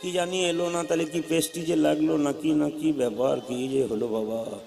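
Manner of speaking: low, hushed fretting; daughter's worried muttering. Retold or imitated. Daughter wonders why her husband stayed away, hushed fretting. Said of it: "মেয়ে কি বলবে?".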